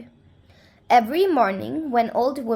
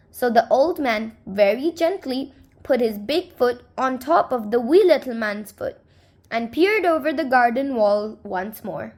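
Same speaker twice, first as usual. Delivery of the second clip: ordinary delivery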